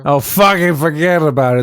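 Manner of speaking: playful mock Brooklyn accent. Joining in on a friend's demo, mock Brooklyn accent. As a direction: echo it in a put-on Brooklyn accent. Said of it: "you can always try a Brooklyn accent".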